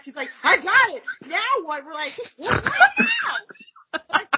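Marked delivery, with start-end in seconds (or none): 0.4-1.8 raised pitch, matter-of-fact question; brisk little quoted question; retold take-charge manager. Retells manager taking charge. 2.3-3.3 very high mock scream; laughing, high-pitched cry of alarm; panicked retold shriek. Group's panicked reply, retold.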